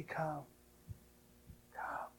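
dropping to a whisper on the second come; soft call to come. Acted. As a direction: breathe the invitation. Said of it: "He says to me".

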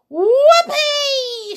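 very high, loud whoop; gleeful shout. A narrator's reading. Grandma finds her voice at last, gleeful shout. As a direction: let out a drawn-out, jubilant whoop. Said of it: "she shouted, finding her voice".

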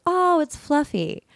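high, slow, sweet voice for Sarah; imitating Sarah. Friend names her ghost cat, high and slow. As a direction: mimic her high and airy, slowly. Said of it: "And she goes".

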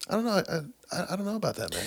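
high, unsure and quiet; doubtful underling voice; hesitant high voice. Retold or imitated. Hesitant employee imitation.

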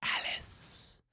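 breathy whispered name; a soft, playful whisper of the name. Real, not acted. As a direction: drop to a secret whisper.

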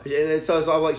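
ordinary talk

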